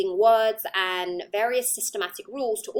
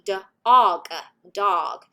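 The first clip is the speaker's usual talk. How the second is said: English speaker's rough American accent, louder open vowel in "O"; attempted American accent; 7 dB louder than usual. Shown as a demonstration. Lecturer demonstrates dog for American students, attempted American accent. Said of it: "in the American accent".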